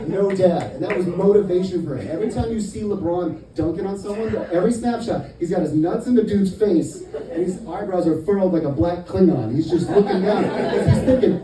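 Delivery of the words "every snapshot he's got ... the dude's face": ordinary talk, nothing marked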